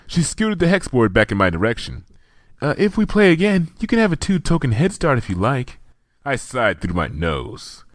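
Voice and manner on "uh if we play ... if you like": a higher, gentle shy mare's voice; timid Fluttershy. A narrator's reading.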